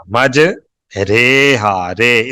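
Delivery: "ریحا" as ray-haa, then "رے" alone as ray; majhool vowel. Shown as a demonstration. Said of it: "اس کو مجہول پڑھیں گے".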